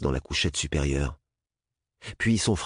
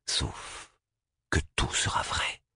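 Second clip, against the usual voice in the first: whispered menace; 6 dB quieter, slower than usual. A narrator's reading. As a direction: breathe it as a threat. Threat ends whispered.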